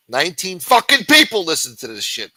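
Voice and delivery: sudden frustrated yell; exasperated shout. Real, not acted. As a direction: blow up at how few are listening. Exasperated outburst at tiny audience.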